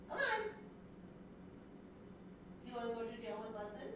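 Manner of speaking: a lightly voiced mock warden talking to a pet; playful pet talk. Real, not acted. Scolding the cat playfully.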